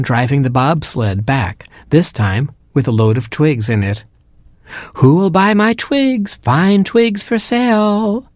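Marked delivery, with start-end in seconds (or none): 5.0-8.3 high, slow hawker's call; seal crying wares. A narrator's reading. Seal hawking twigs to the middle penguin, high slow sales cry. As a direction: sing out the twigs like a market seller. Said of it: "cried the seal".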